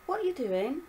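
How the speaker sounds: put-on young girl voice. Violet asks dad.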